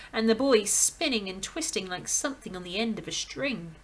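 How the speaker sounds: ordinary delivery, nothing marked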